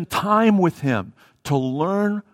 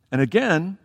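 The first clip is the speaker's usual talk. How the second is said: ordinary delivery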